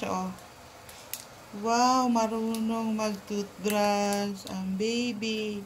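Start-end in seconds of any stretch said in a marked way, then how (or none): 1.6-5.6 very slow, low, soft cooing; cooing praise. Real, not acted. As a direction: praise a little one in drawn-out, soft words.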